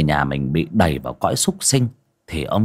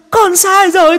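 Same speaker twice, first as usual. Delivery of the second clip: far higher, louder desperate shriek; shrieking ghost plea; 8 dB louder than usual. Acted. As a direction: scream the plea at full force. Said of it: "mà rú lên".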